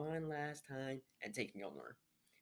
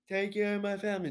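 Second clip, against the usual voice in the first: Hawkeye quote; 9 semitones higher, 7 dB louder than usual. Retold or imitated. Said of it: "Hawkeye's like".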